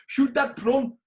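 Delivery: put-on high, loud South African accent. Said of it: "do South African".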